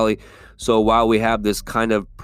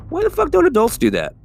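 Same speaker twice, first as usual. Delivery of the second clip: pitched way up, baffled; high incredulous thought; 4 dB louder than usual. Retold or imitated. Wondering aloud after the kids wave, high and baffled. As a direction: ask it high, genuinely puzzled. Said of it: "And I was thinking".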